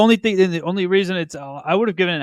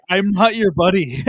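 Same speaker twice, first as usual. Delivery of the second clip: mock threat; 5 semitones higher, 6 dB louder, slower than usual.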